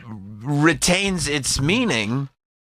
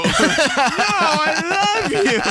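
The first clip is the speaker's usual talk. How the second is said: even higher, laughing lovesick plea; Wayne's World lovesick; much slower than usual. Retold or imitated. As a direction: push it higher and sillier. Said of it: "like the guy from Wayne's World".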